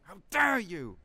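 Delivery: how dare you, shouted, dare hit hard; furious shout. Acted. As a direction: explode on dare. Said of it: "repeated building rant".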